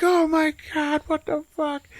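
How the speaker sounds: very high, stretched-out shock; high-pitched astonished stoner; mock freak-out. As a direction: squeal it in comic disbelief. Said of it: "And he's like".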